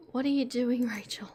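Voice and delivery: hushed whisper to herself. Real, not acted. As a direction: whisper it under your breath. Fumbling with screen on stream.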